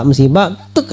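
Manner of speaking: ordinary talk, nothing marked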